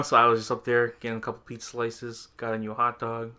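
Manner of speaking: ordinary delivery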